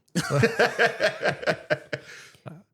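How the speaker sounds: big burst of laughter; loud laugh. Real, not acted.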